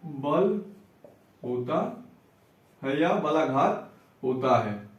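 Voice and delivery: slow, low, soft word-by-word dictation; quiet, drawn-out teacher dictating for note-takers. Shown as a demonstration. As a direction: dictate it piece by piece with long pauses.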